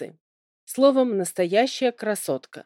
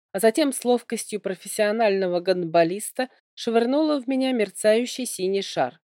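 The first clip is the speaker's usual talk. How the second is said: ordinary delivery, nothing marked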